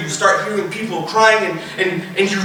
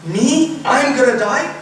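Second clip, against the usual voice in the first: raised, questioning pitch; disbelief; 5 semitones higher, slower than usual. Acted. Dying person hearing of own death, disbelief. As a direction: lift it into an incredulous question.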